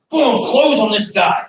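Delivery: slow, loud mock-calm call to close in; cool-headed pro thinking out loud; confident fighter's thought. Instructor voices the fighter he won't be, slow and loud. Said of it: "I'm not gonna be thinking".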